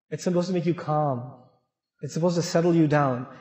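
ordinary delivery

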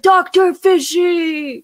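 high, slow, drawn-out cartoon wail; hammed-up villain grief, stretched out; Penguin voice. Mourning his dead fish, hammed-up high wail. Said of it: "And then he's like".

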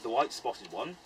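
put-on reciting an old list poem; poem recitation. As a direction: read it like a ballad of names. Reciting old poem of hare names.

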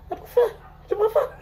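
high, squeaky laughing voice. Real, not acted. Mocking a favourite to viewers, squealing with laughter. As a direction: squeal the words through rising laughter. Cracking up at own mockery.

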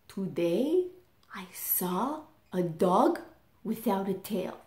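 dad's mock-mysterious hush; hushed secret tone. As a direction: lean in like sharing a secret. Dad teasing kids with tall tale.

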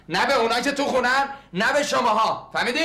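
angry retort. Acted. Angry neighbor refusing to explain.

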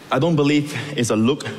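ordinary delivery

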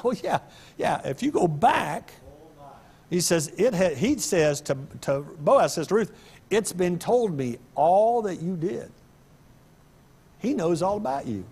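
mild preaching lift; fervent preaching. Acted. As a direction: lean into the story like a preacher. Preaching retells Boaz.

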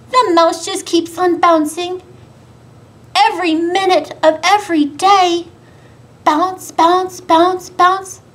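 put-on high, loud bouncy children's rhyme; sing-song rhyme for little ones; rhyme recital. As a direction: chant it with a lively bounce.

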